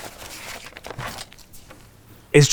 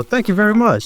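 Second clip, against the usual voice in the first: delighted thanks; 12 semitones higher, faster than usual. Acted. Mock gratitude at shirtless rule.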